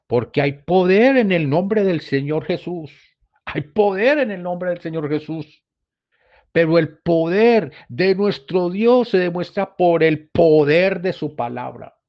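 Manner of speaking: loud, high preacher's proclamation; fiery preaching. Acted. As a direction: proclaim it to the congregation. Preaching at full force. (predicación enérgica y en voz alta)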